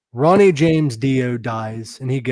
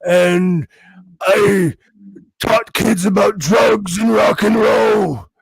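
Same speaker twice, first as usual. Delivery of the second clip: rocker impression building to a slow shout; 3 dB louder, slower than usual. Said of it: "[name]'s like".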